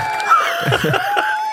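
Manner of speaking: high shrieking burst of laughter. Real, not acted. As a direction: let out a squealing laugh.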